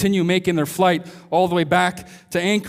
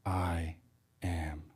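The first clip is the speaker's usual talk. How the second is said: soft hushed reveal; 13 semitones lower, 15 dB quieter than usual. Acted. Rescuer reveals himself.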